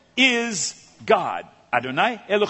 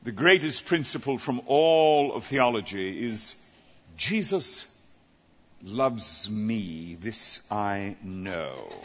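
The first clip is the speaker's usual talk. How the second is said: put-on low, slow, soft lofty scholar reciting a children's song; pompous scholar; 8 semitones lower, 3 dB quieter, slower than usual. Scholar answering the host's question in a low, slow, mock-grave voice. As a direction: drop low and draw it out like a learned man saying something trivial. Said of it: "And the man said".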